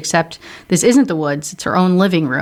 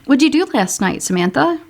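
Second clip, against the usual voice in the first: put-on bunny girl question; 7 semitones higher than usual. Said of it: "bunny girls quiz Samantha".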